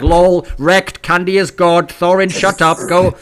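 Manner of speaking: hammed-up gloating fan chant; mock chat jeers. Host imitating online chat cheering against him, loud and mocking. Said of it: "everyone's like".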